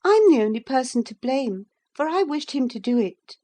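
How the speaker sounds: high, loud, taking the blame; defiant girl. A narrator's reading. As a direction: stand up and own it boldly. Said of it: "she cried".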